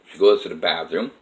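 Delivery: usual speaking voice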